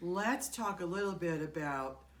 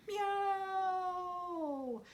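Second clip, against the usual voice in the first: much higher, cute meows; high playful kitty meows; kitten puppet meowing; 12 semitones higher than usual. Pretend. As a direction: meow back like a little puppet cat.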